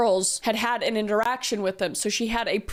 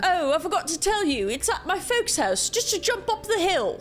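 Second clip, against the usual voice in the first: high, put-on British accent; 9 semitones higher than usual. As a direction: fake a friendly British accent to lure her. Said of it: "he said in a British accent".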